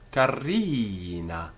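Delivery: "Carina" slow, the ri held very long. Shown as a demonstration. Teacher showing vowel length, "Carina" with long i. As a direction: stretch the middle vowel of "Carina". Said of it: "give it a long I".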